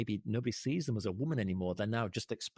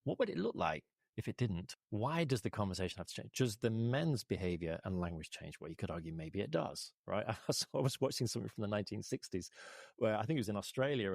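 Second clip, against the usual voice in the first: ordinary talk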